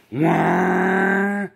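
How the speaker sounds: loud delighted wah; excited yelp. Real, not acted. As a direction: blurt out delight. Delighted exclamation.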